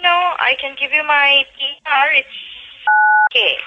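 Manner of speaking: ordinary talk, nothing marked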